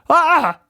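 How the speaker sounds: sharp high yelp of surprise; dad yelps on seeing his son at the window; startled cry. A narrator's reading. Dad's startled high cry. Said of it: "cried his dad".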